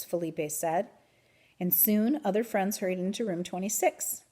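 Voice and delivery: plain reading voice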